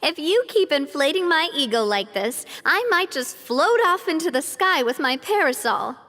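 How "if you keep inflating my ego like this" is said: ordinary delivery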